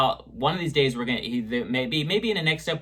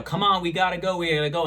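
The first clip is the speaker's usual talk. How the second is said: urgent; 6 semitones higher, faster than usual. Retold or imitated. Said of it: "tries to convince the kid".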